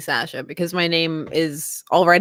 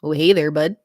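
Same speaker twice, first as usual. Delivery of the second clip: friendly Minnesota greeting, lower than usual; Minnesota accent; 5 semitones lower than usual. Shown as a demonstration. Showing an accent she can do, Minnesota accent. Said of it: "like Minnesota".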